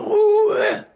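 sharp shout for silence; loud. Acted. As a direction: bark it out. Librarian elf shushing loudly.

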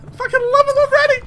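high, quick gush of affection; gushing excitement. Real, not acted. Fond remark repeated higher.

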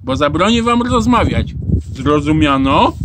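loud teacher's threat to noisy pupils; stern loud warning. A narrator's reading. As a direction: lay down the law loudly. (głośne, surowe ostrzeżenie)

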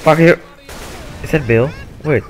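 ordinary talk

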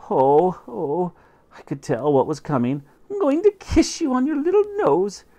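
smother the cat with affection; high cooing mother voice; baby talk. Acted.

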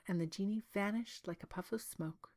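ordinary delivery

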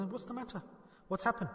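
soft, caring question; gentle concern. Acted. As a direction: ask tenderly, like a kind teacher. Prophet voiced asking gently.

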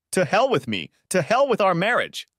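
cracking angry cry; voice cracking in anger. A narrator's reading. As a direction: fling it at her, hurt and furious. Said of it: "His voice cracked".

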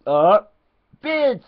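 high, loud cartoonish teacher voice, swearing at the class; squeaky put-on teacher ranting; mock English teacher. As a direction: play a deranged teacher shrieking at his pupils. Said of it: "This is your first day in the English school lesson".

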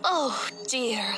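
plain reading voice

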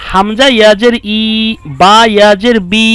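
ই and বি each held long; lengthened vowels. Shown as a demonstration. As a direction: stretch the final vowel in ই and in বি. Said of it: "একটু লম্বা করে পড়েছি".

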